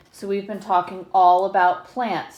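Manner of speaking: ordinary delivery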